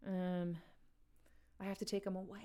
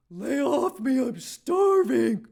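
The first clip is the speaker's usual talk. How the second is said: loud shouting Chris Farley bellow; bellowing comic Farley voice; Chris Farley impression; 5 semitones higher, 18 dB louder, much slower than usual. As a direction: yell it like a frantic Farley. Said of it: "That was my Chris Farley impression".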